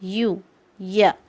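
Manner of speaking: "यू" drawn out slowly as "या", with a clear y glide; yu sound shown. Shown as a demonstration. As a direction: stretch "यू, या" slowly to show the y sound. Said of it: "हम कैसे pronounce कर रहे हैं?". ("यू, या" धीरे-धीरे, साफ़ य की आवाज़ के साथ)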